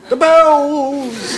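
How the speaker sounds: high, loud hammed-up cry of the bells; Quasimodo cry. Comedian recalling his Quasimodo sketch, a hammed-up loud cry. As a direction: bellow it like the hunchback in the belfry.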